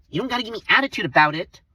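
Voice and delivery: high and loud mocking voice; shrill-sounding imitation of her snapping back; mock whiny coworker. Mimicking the coworker's retort.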